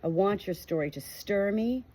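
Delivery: usual speaking voice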